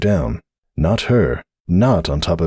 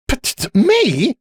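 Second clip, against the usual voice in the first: high, slow, stammered disbelief; sputtering; slower than usual. A narrator's reading. Sunburst suddenly blamed by Applejack, high sputter. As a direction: stumble into an indignant question. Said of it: "Sunburst sputtered".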